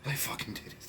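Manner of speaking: hushed, disbelieving whisper of praise; awed whisper. Real, not acted. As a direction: breathe it out in a stunned whisper. Stunned praise of the movie.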